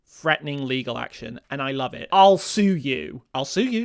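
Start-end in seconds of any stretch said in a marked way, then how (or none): 2.1-3.2 loud, drawn-out mock threat; slow, hammed-up "I'll sue you"; mock legal threat. Voices Jackie threatening legal action. 3.3-3.8 higher-pitched mock threat, lifting at the end; high, hammed-up "I'll sue you"; mock legal threat. Repeats Jackie's threat differently.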